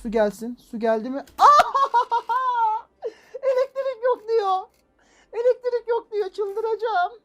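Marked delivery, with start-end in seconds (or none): 1.3-3.1 loud, high-pitched burst of incredulous laughter; disbelieving high laugh. Real, not acted. Juego falla, ríe incrédulo. 3.4-7.1 much higher, louder whiny outcry; exasperated squeal. Real, not acted. El juego dice que no hay electricidad.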